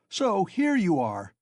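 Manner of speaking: loud, high, slow shout. Acted. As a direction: bellow it at him. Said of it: "he shouted at the top of his voice".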